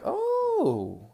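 delighted oh. Retold or imitated. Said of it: "I was like".

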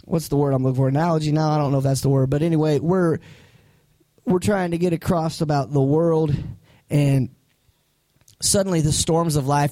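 usual speaking voice

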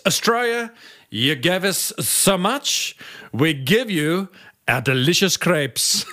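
slow, hammed-up French accent; deliberately bad French accent, drawn out. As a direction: put on a cheesy French accent for the sign-off. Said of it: "There's a bad French accent at the end".

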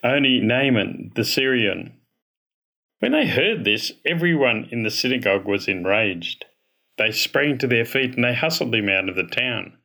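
ordinary delivery

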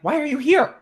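high, loud mock outburst; exasperated. Driver to the lady by the ticket machine, exasperated. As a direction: demand it in comic indignation. Acts out irritation at garage attendant.

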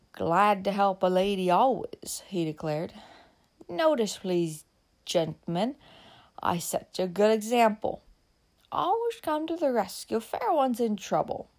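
slow, a hint of drunken swagger; lightly voiced tipsy man. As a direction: show off gallantly, a little tipsy. Tipsy Jim shows off.

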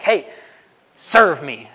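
mock devil's blunt command; blatant devil. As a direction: announce yourself like an obvious devil. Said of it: "not gonna happen like that".

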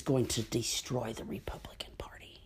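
hushed, relishing whisper; gloating whisper. Real, not acted. Vlogger whispers with relish about the party's downfall. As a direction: whisper it like a delicious secret.

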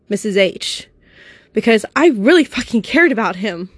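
normal talking voice

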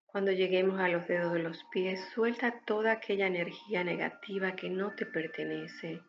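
calm breathing guide, unhurried and gentle; guided meditation. Acted. (voz de meditación guiada)

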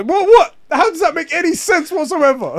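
high, loud incredulous outburst; exasperated disbelief. Real, not acted. Friend ranting about slang, exasperated disbelief. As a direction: push it high, utterly baffled.

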